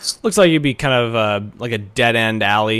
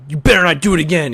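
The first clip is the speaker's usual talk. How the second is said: louder, fast threat; bully threat; 6 semitones higher, 5 dB louder, much faster than usual. Acted. GM as the bully threatening the kid before running, angry. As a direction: spit the warning out quickly.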